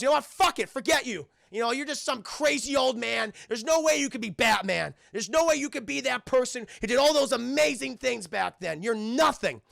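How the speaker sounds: high, loud, scornful rant; angry outburst. Acted. Terry giving up on silent old man, angry outburst. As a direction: lash out in frustration.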